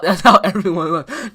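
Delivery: ordinary delivery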